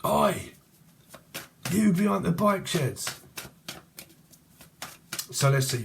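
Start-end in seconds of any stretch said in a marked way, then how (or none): 0.0-3.2 high-pitched call across a yard; raised, carrying "oi" call; playful calling out. Acted. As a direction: hail someone far away in jest. Calling out to imagined person.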